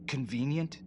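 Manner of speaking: high, rising, incredulous question; indignant echo. Acted. Prisoner doubted by investigator, indignantly incredulous.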